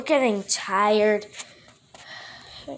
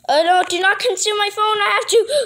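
panicked; 8 semitones higher, 5 dB louder, faster than usual. Acted. Panicked yelling about phone.

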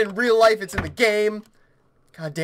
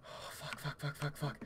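breath-only string of swears; whispered cursing; 5 semitones lower, 19 dB quieter than usual. Real, not acted. Player panicking to self, whispered cursing. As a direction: curse frantically under your breath.

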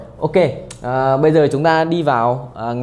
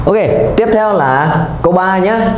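announcing; 5 semitones higher, 5 dB louder than usual. Real, not acted. Teacher announces next question.

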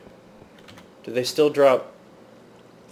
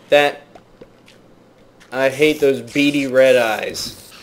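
normal talking voice

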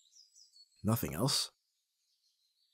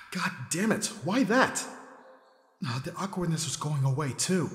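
much higher exasperated cry; mortified groan; 6 semitones higher than usual. Acted. Mortified after the video, high exasperated complaint. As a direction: whine it out in embarrassment.

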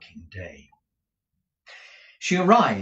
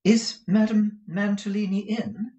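timid, higher-pitched question; lightly voiced nervous Kate, pitched up; faltering; 12 semitones higher, 5 dB louder than usual. A narrator's reading. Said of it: "faltered Kate".

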